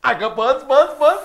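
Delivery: ordinary delivery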